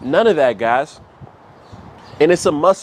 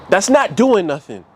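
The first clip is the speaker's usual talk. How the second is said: ordinary talk, nothing marked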